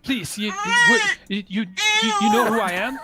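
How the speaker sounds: high, loud, stammering desperation; frantic pleading. Acted. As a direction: beg brokenly for recognition. Trapped amnesiac begs for recognition.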